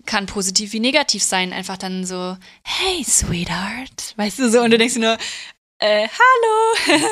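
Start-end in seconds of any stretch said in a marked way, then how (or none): 2.6-4.1 seductive witch whisper. Retold or imitated. Voicing Grace's witchy allure. 5.8-7.0 startled high squeak. Retold or imitated. Own startled reply to Grace.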